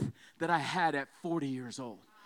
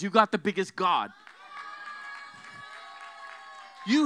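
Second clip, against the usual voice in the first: forceful, driving sermon delivery; full-force preaching; 2 semitones higher, 6 dB louder than usual. Real, not acted. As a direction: drive each line home to the congregation.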